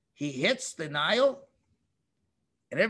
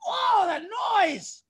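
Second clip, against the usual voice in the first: exasperated cry; 12 semitones higher than usual. Acted. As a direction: cry out at the unbearable noise. Groaning at million frogs.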